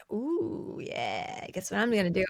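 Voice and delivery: creepy seductive. Retold or imitated. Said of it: "they're like".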